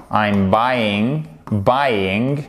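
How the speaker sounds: "buying" as "BY-ing", diphthong gliding into -ing; correct pronunciation modelled. Shown as a demonstration. Teacher drilling learners on the word, careful "BY-ing" for "buying". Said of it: "et pas buying".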